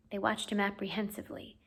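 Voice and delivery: unvoiced hushed narration; whispering. A narrator's reading. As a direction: whisper so he stays asleep. Narrator whispers tense moment.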